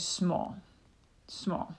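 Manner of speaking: "small" set apart and repeated; teacher isolating the syllable "small"; separate syllable. Shown as a demonstration. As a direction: sound out "small" as its own syllable. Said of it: "we have to say it separately".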